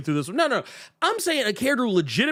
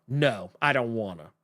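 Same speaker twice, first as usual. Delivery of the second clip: low, sullen refusal, a little softer; reluctant protagonist; 10 semitones lower, 4 dB quieter than usual. Acted. Whiny protagonist refusing the call, low and reluctant. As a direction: drop low and mumble a refusal. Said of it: "whenever the protagonist is like".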